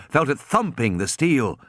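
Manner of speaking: ordinary delivery, nothing marked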